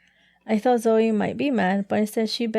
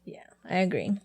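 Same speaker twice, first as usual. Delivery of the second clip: soft whispered agreement; 2 semitones lower, 6 dB quieter than usual. Real, not acted. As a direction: breathe the agreement quietly.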